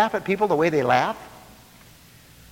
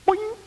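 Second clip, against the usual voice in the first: high-pitched "boing"; boing noise. Acted. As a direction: bounce off someone with a high boing. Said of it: "bump up against them".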